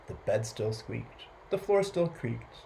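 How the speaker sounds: plain reading voice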